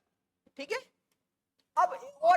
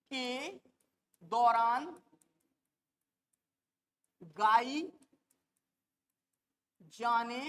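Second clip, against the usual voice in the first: word by word, long pauses between words; slow dictation; 5 semitones lower, 4 dB quieter, much slower than usual. Shown as a demonstration. Teacher dictating notes word by word to the class, slow and spaced. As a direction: dictate each word slowly so students can write. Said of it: "teacher dictates word by word".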